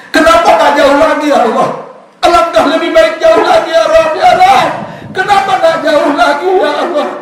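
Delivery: high, loud crying plea to God; high wailing lament of a dying man. Acted. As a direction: cry out like a dying man longing. Dying husband's cry voiced.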